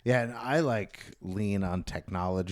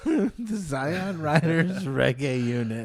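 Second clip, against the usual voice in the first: laughing echo; 7 semitones higher, 4 dB louder, slower than usual. Real, not acted. Echoing the band name back, laughing. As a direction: repeat the name, laughing, slow and high.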